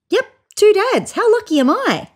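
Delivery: high, bright-eyed boast; proud excitement. A narrator's reading. Child proudly showing two dads.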